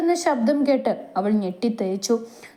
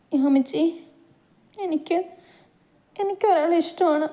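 higher, halting, close to tears; tearful confession; 7 semitones higher than usual. Acted. Girl confessing her love to her sister, tearful and halting. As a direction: force out the confession through tears. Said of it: "ദച്ചുവിൻ്റെ മിഴികൾ ചാലിട്ടൊഴുകാൻ തുടങ്ങി".

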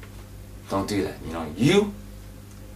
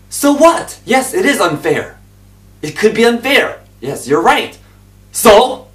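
much higher, loud retort; loud pulpit retort; fiery preaching; 12 semitones higher, 11 dB louder than usual. Acted. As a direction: shout down the objection. Shouting back at the objection.

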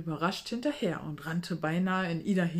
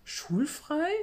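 confused; 9 semitones higher than usual. A narrator's reading. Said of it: "wiederholte Ida verwirrt".

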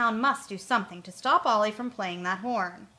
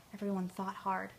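usual speaking voice

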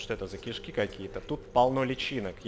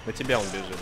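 ordinary talk

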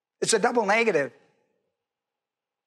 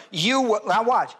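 ordinary talk

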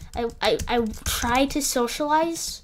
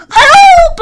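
very high, loud yelling cry; real frantic scream for help; 19 dB louder than usual. Real, not acted. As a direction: yell for help as you get swarmed. Ganged up on in game.

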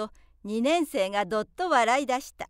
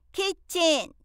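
higher playful shouted nickname; 6 semitones higher than usual. A narrator's reading. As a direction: yell the nickname to get laughs. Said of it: "と叫んだ".

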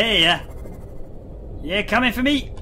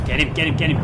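normal talking voice

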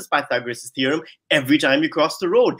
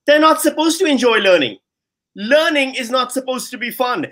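much higher and louder, indignant; emphatic outburst; 9 semitones higher, 3 dB louder than usual. Real, not acted. Debate host hitting back at the student, indignant and loud. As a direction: fire the rebuttal back at them.

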